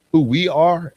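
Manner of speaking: normal talking voice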